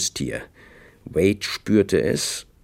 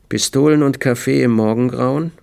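ordinary delivery

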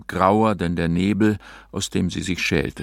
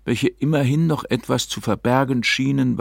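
usual speaking voice

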